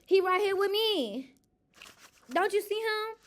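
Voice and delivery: pointing at her invisible friend, high and sassy; playful mock voice. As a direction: tease them in a high put-on voice. Playful bit about her higher power.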